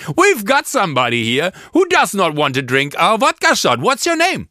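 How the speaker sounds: a big rise on the name question; mock MC voice. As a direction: play to the crowd like a cheesy host. Host on mic mocking guest.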